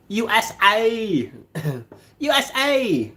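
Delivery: high, slow put-on accent saying U S A; attempted accent. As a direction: stretch out U S A in a silly accent. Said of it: "copy the accent, guys".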